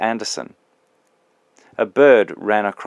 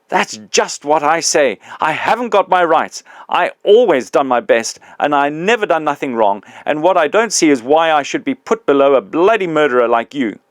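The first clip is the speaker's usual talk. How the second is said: higher, louder aggrieved grumbling; a working man with a grievance, loud and higher; the big ghost confronting his old employee, loud and aggrieved; indignant big ghost; 6 semitones higher, 6 dB louder than usual. Acted.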